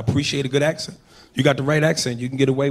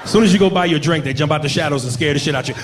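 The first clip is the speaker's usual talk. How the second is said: ordinary talk, nothing marked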